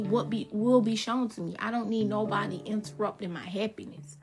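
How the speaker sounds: normal talking voice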